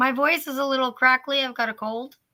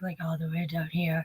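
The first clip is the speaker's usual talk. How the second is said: low, soft demo of a hoarse morning voice; 7 semitones lower, 9 dB quieter than usual. Shown as a demonstration. Guest showing how low yesterday's hoarse voice was, dropped deep. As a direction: drop right down in pitch and volume. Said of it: "I could only speak".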